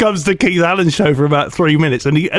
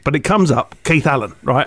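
ordinary delivery

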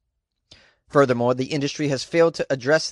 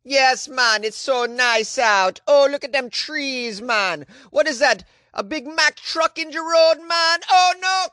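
high, cheerful put-on Jamaican driver, slow at first and rising into alarm; hammed-up Jamaican accent building to panic; mock Jamaican accent; 10 semitones higher, 3 dB louder, slower than usual. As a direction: play her chatting away in a Jamaican accent until the truck appears. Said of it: "I just imagine she's riding in a car".

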